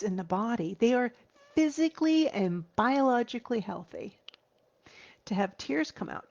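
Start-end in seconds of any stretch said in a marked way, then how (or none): none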